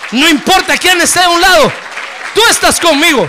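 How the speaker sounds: high, loud declaration of faith; triumphant shout. Pretend. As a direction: shout it out to the Lord. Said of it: "usted va a decir".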